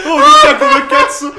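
very high, loud startled yelp; shocked scream. Real, not acted.

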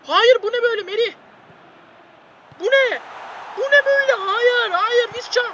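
high, loud disbelieving shout at the screen; exasperated yelling. Real, not acted. Gamer yelling at his team after a blunder. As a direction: yell in disbelief at the defenders. Goal chance conceded, shouting.

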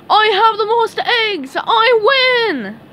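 high, loud triumphant shout; Patrick shouting. A narrator's reading. Patrick runs home with all the eggs, shouting. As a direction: crow over the victory at full voice. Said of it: "ran home shouting".

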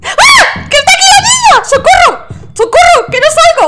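shrill terrified screaming, very high; alarm shout. Real, not acted. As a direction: scream in sheer panic. Ghost appears, cornered.

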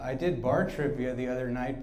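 usual speaking voice

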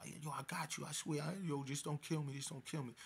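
high imitation of frightened begging; mock pleading. Retold captive begging storyteller not to kill him, pleading. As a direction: imitate a man begging for mercy. Captive begs for his life.

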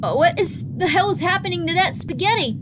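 ordinary talk, nothing marked

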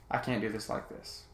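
usual speaking voice

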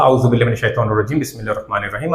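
soft, measured Quranic recitation; quiet recitation. Shown as a demonstration. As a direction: recite the opening formula softly and reverently. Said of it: "পুরোটাই আমি পড়ে শোনাচ্ছি". (নরম স্বরে কুরআন তিলাওয়াত)

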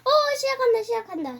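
high, excited call as it kicks off. Real, not acted. Player announcing the game start, excited. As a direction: pitch up with sudden excitement.

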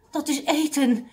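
high, mildly puffed exclamation; panting. A narrator's reading. Mr Abdul realising during exercise, mildly panting. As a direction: say it as if short of breath. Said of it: "zei meneer Abdul hijgend".

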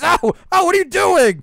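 high, loud cry of pain; pained yelp. Acted. Boy sprayed by the principal yelps in pain.

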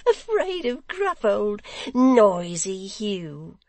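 high, slow, amused mockery; merry, high-voiced teasing; laughing. A narrator's reading. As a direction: draw it out high and loud through laughter. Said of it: "Barnaby stopped in his laugh".

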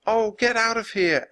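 high, irritated yell; shouting at a pest to leave; indignant shout. A narrator's reading. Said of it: "Annie shouted at the vulture".

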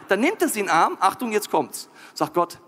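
normal talking voice

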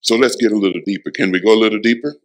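low, fast, intimate preaching voice; hushed sermon confiding to the congregation; soft low preaching. Acted. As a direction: draw them in close and quiet. Preacher drops into intimate low register.

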